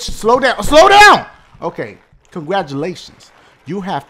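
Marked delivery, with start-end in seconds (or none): 0.6-1.3 frantic yell at the game; urgent shout. Real, not acted. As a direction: bark it at the screen in a panic.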